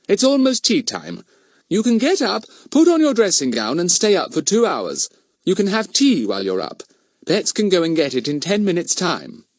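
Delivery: high-pitched motherly voice; brisk mother. Acted. Mother tells sick son he may get up, brisk. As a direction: give instructions as a fussing mother.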